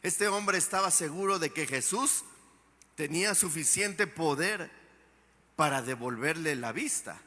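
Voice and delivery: ordinary delivery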